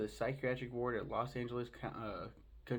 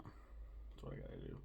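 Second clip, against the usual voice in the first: deep slow voice; 12 dB quieter than usual. Pretend. Dropped low slow aside.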